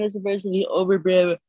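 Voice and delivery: ordinary talk, nothing marked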